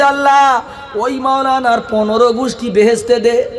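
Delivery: high blessing called out by the wife; joyful wife. Retold or imitated. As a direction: cry it out as a thrilled wife. Delighted wife blesses the preachers.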